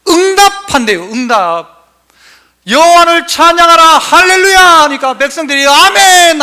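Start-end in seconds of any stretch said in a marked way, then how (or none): 2.7-4.6 loud, high proclamation; ringing preacher's summons, raised in pitch; call to praise. Acted. 5.7-6.4 high, loud Amen; raised, emphatic cry of assent; congregational amen. Acted. People answer the call.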